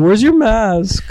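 high, slow mocking girl; mock high voice asking about the mask. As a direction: mimic a prissy date asking. Mocking girl quote, high pitch.

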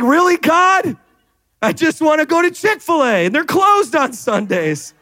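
high whiny complaint, easing down at the end; mock exasperation. Churchgoer wanting Chick-fil-A complains to God, mock exasperated. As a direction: gripe to heaven like a thwarted customer.

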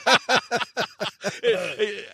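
high breathy chuckle; light high laugh. Real, not acted. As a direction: laugh it off, pleased. Laugh after story.

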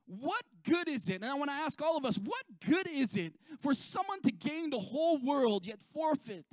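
normal talking voice

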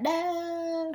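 a hammed-up movie cry; retold cry of no. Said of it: "and she's like".

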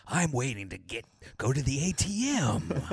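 mock in-your-face video voice; put-on video voice. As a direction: imitate an over-the-top video host. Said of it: "has this voice".

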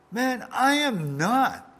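high, loud, slow and stubborn denial; defensive denial. Acted. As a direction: dig in and refuse. Peter's second denial voiced.

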